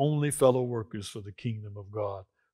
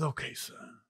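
hushed father; 2 semitones higher, 9 dB quieter than usual. Acted. Father answers Son, hushed.

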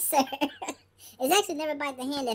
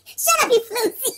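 mock scolding; 5 semitones higher, 6 dB louder, slower than usual. Playful scolding in character.

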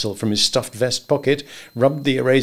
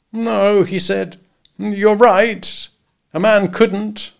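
higher, louder, bluff and jovial; higher voiced good old boy; hearty Henry; 9 semitones higher, 3 dB louder, much slower than usual. A narrator's reading. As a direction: boom friendly advice across the desk. Henry conceding querulously.

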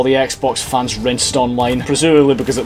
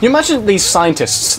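ordinary delivery

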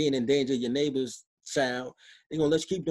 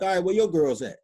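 retorting louder; 4 semitones higher, 6 dB louder than usual. Retold or imitated. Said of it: "I'm like".

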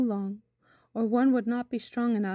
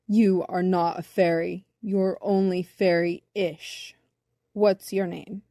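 lower, measured voice of judgement; slow, deliberate bumblebee; 3 semitones lower, slower than usual. A narrator's reading. Bumblebee rules on whether boy is fairy, slow and deliberate. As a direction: pronounce the verdict with deliberation. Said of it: "he said slowly".

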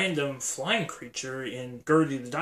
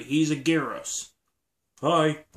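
ordinary talk, nothing marked

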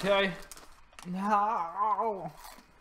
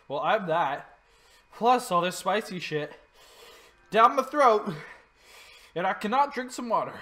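frustrated complaint; 4 semitones higher, 7 dB louder than usual. Real, not acted. Throat burning, no water.

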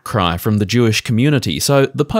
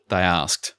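ordinary delivery

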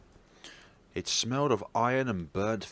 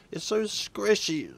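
high, slow grossed-out whine; disgusted; 10 semitones higher than usual. Acted. Stepping on organs, a high disgusted whine. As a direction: squirm at the squish.

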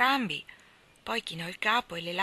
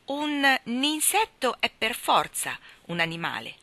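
firm, raised, emphatic insistence; determined correction, higher and louder; resolute; 6 semitones higher, 5 dB louder, slower than usual. A narrator's reading. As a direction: insist slowly and loudly, like a stubborn child. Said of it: "aggiunse risoluta".

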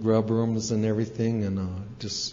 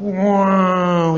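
high, loud drawn-out swoop; long exultant cry, acted out; soaring "wow"; 6 semitones higher, 6 dB louder than usual. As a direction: imitate a flip through the air. Imitates the eagle's flip.

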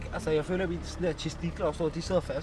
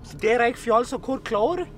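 ordinary talk, nothing marked

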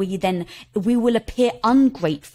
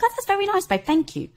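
high, airy mock-polite dismissal; imitating a breezy, nonchalant thanks; nonchalant brush-off; 10 semitones higher than usual. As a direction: wave it away sweetly in a raised pitch. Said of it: "Why are you being so nonchalant?".

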